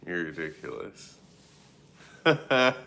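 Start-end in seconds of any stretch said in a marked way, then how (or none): 2.2-2.8 loud laugh. Real, not acted. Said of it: "laughing loudly at a chat comment".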